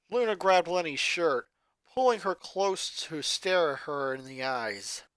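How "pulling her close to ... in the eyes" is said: plain reading voice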